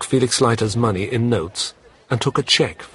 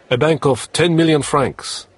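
higher, louder formal casino call; croupier announcing; 8 semitones higher, 6 dB louder than usual. Acted. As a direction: announce it to the whole table.

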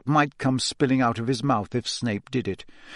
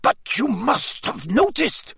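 high, slow, urgent plea; agitated Karkaroff; 11 semitones higher, slower than usual. A narrator's reading. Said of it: "in an agitated voice".

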